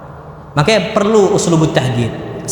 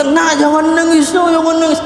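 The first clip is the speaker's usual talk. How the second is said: mock hushing parent; 10 semitones higher than usual. Parent hushing a crying child.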